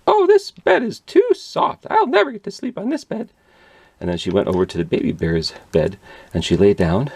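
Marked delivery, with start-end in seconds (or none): 0.1-3.3 Goldilocks complaining. A narrator's reading. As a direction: whine like a fussy little girl. Goldilocks on soft bed.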